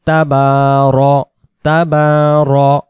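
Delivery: তাবার- said slowly with a short ro, never ra; drawn tabaro. Shown as a demonstration. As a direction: stretch তাবার- and keep the r vowel short. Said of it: "রা হবে না।". (তাবার- ধীরে, রা নয়)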